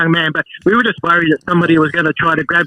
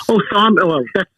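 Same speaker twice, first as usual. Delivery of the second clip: normal talking voice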